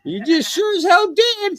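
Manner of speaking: high, slow bird voice; squeaky parrot voice. Acted. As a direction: squawk it like a pet bird. Bird voice comeback.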